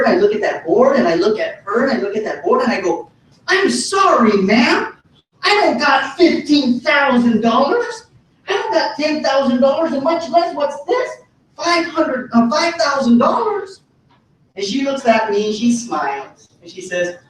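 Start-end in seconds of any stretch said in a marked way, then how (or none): 3.5-13.8 high-pitched, hammed-up disbelief; confused dismay. Teenager telling friend's mom he lacks the money, mock dismay. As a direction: retell it as a high, incredulous protest. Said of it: "I was even more confused".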